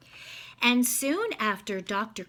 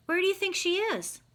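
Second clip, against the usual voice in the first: bright teacher question; 7 semitones higher, faster than usual. Shown as a demonstration. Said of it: "ask that person".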